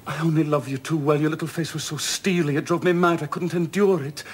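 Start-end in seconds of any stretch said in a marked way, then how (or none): none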